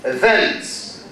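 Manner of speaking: "Events" stressed on the second syllable, e-VENTS; short first vowel, strong VENTS in "Events". Shown as a demonstration. Teacher repeats the example for the class, "Events" stressed e-VENTS. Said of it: "Second syllable".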